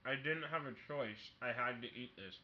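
talking while eating a brownie; words round a mouthful of food; mouth full. Real, not acted. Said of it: "I'm also eating on a podcast".

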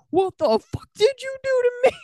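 very high, hammed-up mom screech without much volume; imitated yelling mom. Friend imitating a possessed mom accusing her kid, high mock yell. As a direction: put on an outraged mom, pitch way up. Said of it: "just to yell at you".

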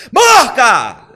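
high, loud warning shout; sudden shout of warning at a friend; startling yell. Retold or imitated. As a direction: yell it to make them jump. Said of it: "te grita de una forma en que te asusta".

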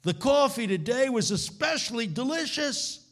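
high voice of a rough street man. Retold or imitated. Homeless man offers the preacher his coffee, voiced high. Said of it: "He said".

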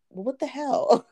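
high-pitched, put-on exasperated protest; mock exasperation. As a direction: act out a baffled complaint. Retelling her frustrated reaction.

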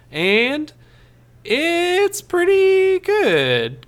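very high, slow, stretched-out words; drawn-out hedging verdict. Real, not acted. Host giving the movie verdict, drawn-out high hedge.

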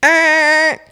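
high wrong answer buzz; buzzer noise. Acted. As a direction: buzz like a game show buzzer.

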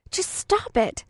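high, sharp outburst at a mean classmate; exploding. Acted. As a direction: burst out, fed up. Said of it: "I exploded".

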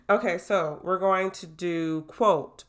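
ordinary talk, nothing marked

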